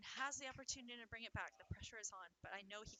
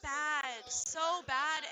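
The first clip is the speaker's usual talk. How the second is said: much louder, higher release of tension; real stressed outburst; 4 semitones higher, 10 dB louder, much slower than usual. Real, not acted. Commentator exclaiming to the chat after the nerve-racking set, loud and high. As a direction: let the nerves burst out loud. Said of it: "that stressed me out".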